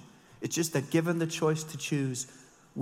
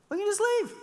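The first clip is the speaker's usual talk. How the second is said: high, louder singsong of the easy way out; mock breezy; 4 dB louder than usual. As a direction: toss it off brightly like it's obvious. Mocking easy way out.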